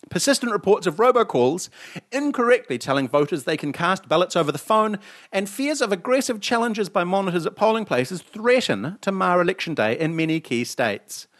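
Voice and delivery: lightly newsy read-aloud; news read. Acted. Host reading the poll story to cohost, lightly newsy. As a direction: read the headline story like a bulletin.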